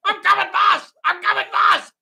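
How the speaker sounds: very high, loud squeaky shout; eager henchman yell. Acted. Henchman answering his boss's call, high comic shout. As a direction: holler back in a comic high voice. Plays Chang answering the boss.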